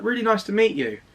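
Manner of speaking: high, sing-song imitation of a nurse; imitated friendly nurse. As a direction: pitch it up high as a friendly greeting. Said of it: "She was like".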